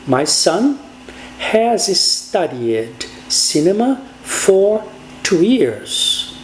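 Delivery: very slow with pauses between word groups; slow model English. Shown as a demonstration. Teacher modelling the English sentence, slow and spaced. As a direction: dictate the sentence slowly. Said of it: "eu teria que dizer".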